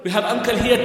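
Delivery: ordinary delivery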